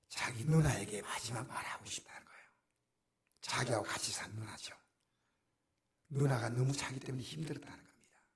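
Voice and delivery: soft unvoiced whisper, leaning in close; hushed retelling. Preacher to congregation recounting the patient's last wish, whispering. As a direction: tell it like a secret at a deathbed.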